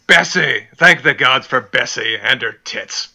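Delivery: loud, slow, hearty royal bellow; Robert's bawdy toast. Acted. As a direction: roar it out like a drunk king toasting.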